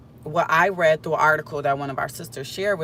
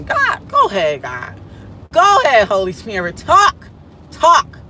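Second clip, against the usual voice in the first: calling out to the Holy Spirit, much louder and higher; 4 semitones higher, 7 dB louder than usual. Real, not acted. Speaker to viewers, urging the Holy Spirit on, loud high call.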